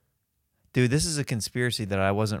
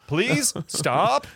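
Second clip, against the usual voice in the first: laughing plea; 10 semitones higher than usual. Acted. Game kid pleading.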